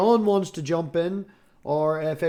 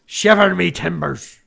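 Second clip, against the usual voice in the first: slow loud Irish pirate growl; Irish pirate accent, slow and loud; 5 dB louder than usual. Pretend. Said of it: "That's not terrible".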